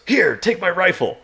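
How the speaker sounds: high, loud hurried offer; retold urgent order. Buddy hands hunter his rifle, urgent high call.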